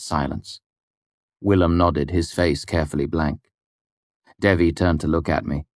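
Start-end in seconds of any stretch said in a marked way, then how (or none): none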